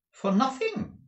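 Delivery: high incredulous question; astonished cry. A narrator's reading. Wife questioning the bargain price, astonished high cry. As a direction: echo it in high-pitched disbelief. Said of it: "cried Madame".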